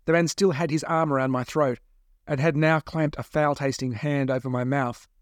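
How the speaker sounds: usual speaking voice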